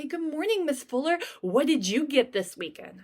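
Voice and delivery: cheerful student. Retold or imitated. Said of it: "She's gonna say".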